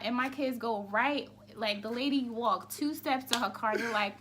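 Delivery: ordinary delivery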